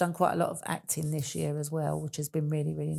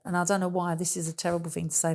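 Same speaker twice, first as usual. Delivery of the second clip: ordinary delivery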